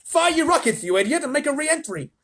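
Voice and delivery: high, loud demand; yelling president. A narrator's reading. The president yells at the astronauts over radio, high and loud. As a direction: bark at the astronauts. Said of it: "shouted the president".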